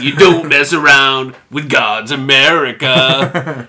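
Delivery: loud, slow, hammed-up country indignation; pissed country singer. Country singer scolding the monkey for asking why, mock pissed. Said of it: "country singer's pissed at the monkey".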